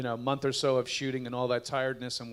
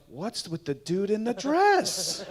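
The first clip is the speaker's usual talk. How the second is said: mock outraged neighbor; 8 semitones higher, 4 dB louder, much slower than usual. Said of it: "but then they go".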